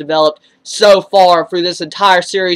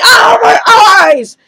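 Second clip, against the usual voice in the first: high, loud yelp of pain, drawn out slowly; pained shout; 12 semitones higher, 5 dB louder, much slower than usual. Retold or imitated. Shinichi hit in the eyes by flying buttons, high pained shout. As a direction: cry out like something just hit your eyes. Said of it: "And he's like".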